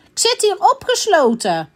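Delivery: high-pitched princess protest; calling out. A narrator's reading. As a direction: call down indignantly from the tower. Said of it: "riep de prinses".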